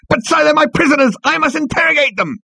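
very high, strained protest; exasperated high-pitched underling; frustrated squealing officer. A narrator's reading. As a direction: squeal it at your superior.

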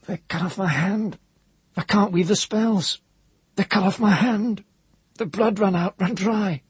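high, strained bursts of words; forced out in bursts. A narrator's reading. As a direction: push each phrase out in jerks. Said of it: "forcing the words out in bursts".

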